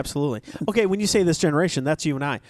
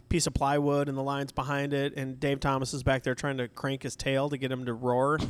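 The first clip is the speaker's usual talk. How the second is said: usual speaking voice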